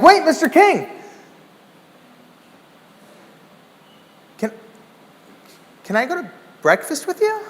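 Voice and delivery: high, hopeful asking; high pleading question; eager plea. Retold or imitated. Begging the celebrity.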